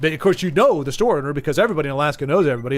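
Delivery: usual speaking voice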